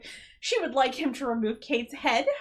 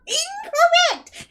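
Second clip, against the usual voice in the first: Incorrect stretched out slowly, much higher and louder; drawn-out outraged verdict; 9 dB louder, much slower than usual. Real, not acted. Co-host ranting at the movie's Hot Rod, slow drawn-out loud verdict. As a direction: wail Incorrect like a game-show buzzer.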